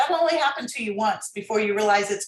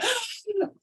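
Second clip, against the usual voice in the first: big, high audible in-breath; exaggerated gasp; 11 semitones higher, 3 dB quieter than usual. Shown as a demonstration. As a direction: suck in air noisily, overdoing it. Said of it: "like, an exaggerated breath".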